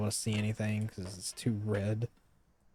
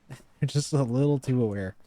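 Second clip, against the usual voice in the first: loud, higher, laughing through the words; real laughing; 4 semitones higher, 7 dB louder than usual. Real, not acted. Player joking that ghosts are too self-aware, laughing loudly. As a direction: let the laugh push the line out louder.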